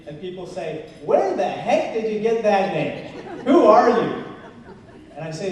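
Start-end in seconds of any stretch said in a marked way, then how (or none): 1.0-4.2 mock-incredulous questions, building louder; baffled questioning. People reacting to the candidate's unusual name, mock bafflement. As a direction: imitate people baffled by you, punching the last question high and loud.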